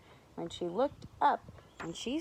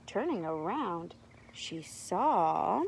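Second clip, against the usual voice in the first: ordinary delivery, nothing marked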